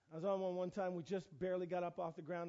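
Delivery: ordinary talk, nothing marked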